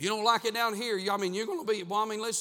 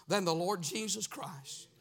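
usual speaking voice

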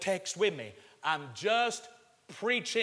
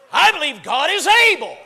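loud, high preacher's shout; emphatic declaration; 10 semitones higher, 16 dB louder than usual. Real, not acted. Preacher declaring faith to the congregation, shouted high. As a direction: proclaim it loudly, pitch soaring.

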